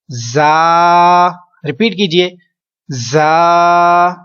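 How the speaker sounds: जा with a clear hiss, drawn out; teacher modelling the hiss; whistling जा. Shown as a demonstration. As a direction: let जा whistle. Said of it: "सीटी की आवाज लाजमी आनी चाहिए".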